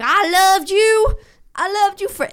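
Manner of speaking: put-on pining movie boyfriend, dragged out and swooning; hammed-up romantic movie lead. Imitating the Notebook hero's big love confession, hammed up. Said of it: "He's like".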